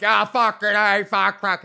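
put-on loud drunk grumble; mock a drunk giving up loudly.

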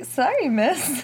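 high, slow polite lady voice. Pretend. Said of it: "mock lady voice exchange".

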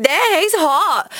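high, drawn-out "dang"; smitten admiration. Retold or imitated. Woman recalling her first sight of a radio crush, playfully smitten. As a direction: act out being wowed by a crush. Smitten reaction to hot guy, acted.

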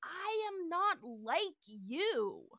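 iconic dramatic line. Retold or imitated. Retelling Spock's iconic line to Layla, melodramatic. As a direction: draw it out with mock melodrama. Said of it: "he has an iconic line".

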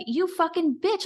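high, exasperated mock curse at the psychic; mock angry outburst. Friend retelling the psychic visit, mock exasperated curse. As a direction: spit it out like you're fed up.

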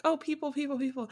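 high, eager squeal of a happy dog; excited dog. Retold or imitated. Owner voicing her cat excited about visitors, high and eager. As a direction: voice the dog thrilled that guests arrived. Dog voiced greeting guests.

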